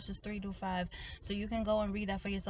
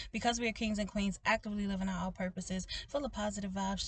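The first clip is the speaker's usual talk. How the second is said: usual speaking voice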